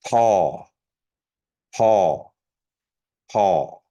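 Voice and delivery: Paw with a breathy aspirated p; regular P. Shown as a demonstration. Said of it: "regular P".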